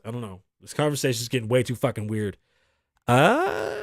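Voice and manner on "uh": long rising, high-pitched "uh"; high drawn-out uh. Real, not acted. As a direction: stretch a wailing uh upward. Stalling after weird conversation.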